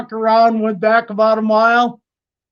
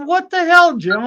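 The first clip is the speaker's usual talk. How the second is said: exasperated retort; 9 semitones higher than usual. Retold or imitated. To coworker Jim after he mocks the snake rescue, mock exasperated. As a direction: throw it back at him, half laughing.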